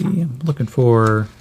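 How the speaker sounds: ordinary delivery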